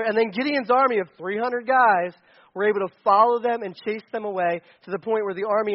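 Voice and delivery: normal talking voice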